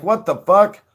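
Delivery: high, loud startled "What the fuck?"; shocked cops. Retold or imitated. Cops watching Josh run off, loud shocked outburst. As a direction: blurt it out as the stunned cops. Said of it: "And they're like".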